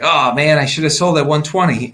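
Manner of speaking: oh man, I should've sold; mock regret. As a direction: play the kicking-yourself investor. Investor regretting selling late.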